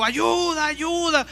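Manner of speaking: high, drawn-out cry for help; injured man's high repeated help call. Acted. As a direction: keep shouting for help, tiring. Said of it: "gritó".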